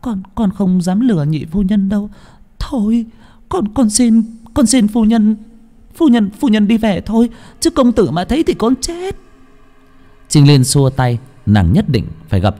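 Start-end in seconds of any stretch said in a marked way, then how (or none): 0.0-9.2 high, stammering tearful maid; blubbering servant begging to leave; whimpering, near tears. Acted. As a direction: plead in a frightened whine, pitched far up. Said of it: "mếu máo".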